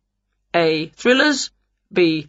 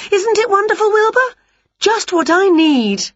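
much higher, loud and gushing; thrilled witch; 13 semitones higher, 4 dB louder than usual. A narrator's reading. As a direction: gush like an overexcited witch. Witch gushing to her cat.

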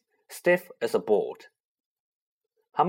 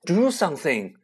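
desperate; 4 semitones higher, 3 dB louder than usual. Acted. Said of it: "he said desperately".

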